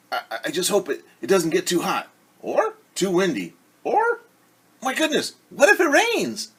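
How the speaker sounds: high, fretful hen voice rising to a squeak; worried Henny Penny. Acted. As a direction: fret like a nervous little hen. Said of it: "Henny worries, voiced high".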